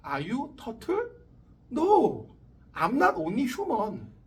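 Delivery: put-on very slow, deliberate Korean-accented English; mock English. Teacher parodies the gangster exchange, slow Korean-accented English.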